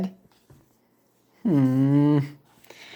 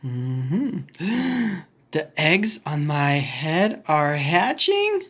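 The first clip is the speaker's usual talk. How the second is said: usual speaking voice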